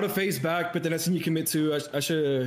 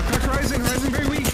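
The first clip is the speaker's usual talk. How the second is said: high, louder excited callout; 10 semitones higher, 5 dB louder than usual. Real, not acted. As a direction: shout the damage report.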